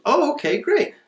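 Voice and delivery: bright, very high "oh okay, great"; chirpy high reaction. Retold or imitated. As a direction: squeak it out like a delighted seller. Seller's excited phone reaction.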